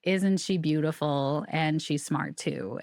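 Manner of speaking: very slow, each word drawn out; slow spirit voice. Retold or imitated. Medium passes her uncle's words to the grieving aunt, slowly.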